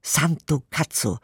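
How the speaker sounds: ordinary talk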